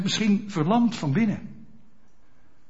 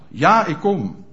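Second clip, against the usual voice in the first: voicing the answer; 5 dB louder, slower than usual. Congregant's answer voiced by preacher.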